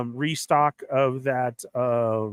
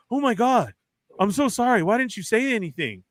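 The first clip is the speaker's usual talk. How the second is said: higher-pitched gushing apology in imitation; imitated fluster, pitched up; flustered mock apology; 6 semitones higher than usual. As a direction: apologize profusely as the embarrassed worker. Quotes flustered worker apologizing.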